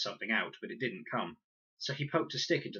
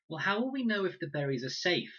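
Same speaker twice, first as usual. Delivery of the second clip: put-on higher worried girl voice; girl's voice; 11 semitones higher, 5 dB louder than usual. A narrator's reading. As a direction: ask it as an anxious girl. Girl character voiced in story.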